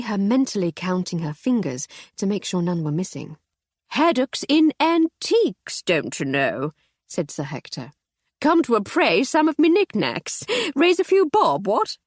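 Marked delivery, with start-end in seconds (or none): 3.9-6.7 put-on high, blustering old gent; jovial old colonel. Voiced Sir Hector. 8.4-12.0 put-on high, blustering old gent; jovial old colonel. Voiced Sir Hector.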